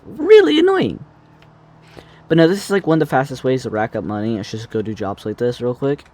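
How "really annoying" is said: exasperated whine. Acted. Complaining about repeating job.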